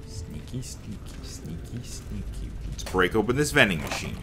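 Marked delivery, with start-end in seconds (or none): 0.1-2.5 very soft, near-whispered sneaky chant; hushed sneaking. Pretend. Player creeping back into hiding, hushed and sneaky. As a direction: creep along under your breath.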